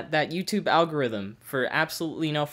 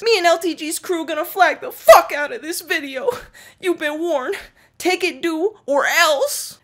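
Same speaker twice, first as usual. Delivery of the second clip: high, loud hammed-up fanboy threat; mocked threatening troll; 12 semitones higher, 6 dB louder than usual. Reading the second comment's warning, mock threatening troll. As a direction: imitate a commenter making big empty threats.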